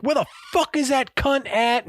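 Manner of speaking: imitated high-pitched tantrum; Gordon Ramsay. Chef hunts for the missing plate wiper, losing it. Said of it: "Gordon Ramsay's losing his shit".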